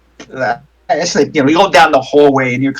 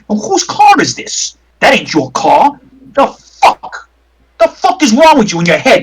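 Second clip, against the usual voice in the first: angry; 3 semitones higher than usual. Acted. Bruiser yelling at player character.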